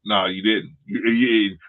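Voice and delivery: usual speaking voice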